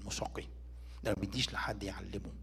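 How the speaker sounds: hushed, breathy aside; soft confiding whisper. Real, not acted. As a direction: drop it to a near whisper. Preacher drops to a whisper.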